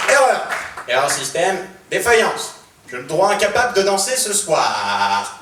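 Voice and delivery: loud broken robot voice. Acted. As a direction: speak like a malfunctioning robot, loud. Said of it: "avec un module de voix défaillant".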